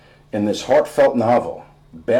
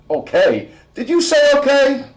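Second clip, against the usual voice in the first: much higher and louder, outraged squeak; a dad offended on behalf of jazz; indignant disbelief; 6 dB louder than usual. Acted. Dad indignant at his son.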